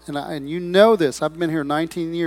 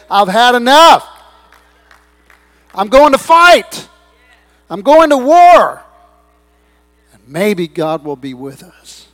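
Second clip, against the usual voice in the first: high, loud defiant declaration; Jonathan's battle cry; 7 semitones higher, 15 dB louder, slower than usual. Acted. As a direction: declare war like a warrior who has had enough. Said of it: "rise up and say".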